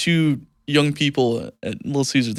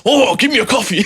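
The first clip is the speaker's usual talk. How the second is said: high, hammed-up samurai cry; mock anime warrior ordering; 9 semitones higher, 4 dB louder than usual. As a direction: belt it out like a cartoon samurai. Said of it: "you sound like a warrior when you talk".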